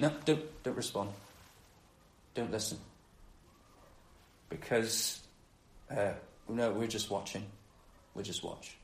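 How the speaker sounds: low, very soft acted muttering; quiet, withholding aside; hushed refusal. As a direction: keep it under your breath, as if conspiring to stay silent. Silent resisters muttering among themselves.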